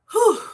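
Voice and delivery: high, loud exhale of relief; relieved whew. Real, not acted. Streamer blows out a whew to get herself together. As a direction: blow out the emotion to steady yourself. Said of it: "let me get myself together".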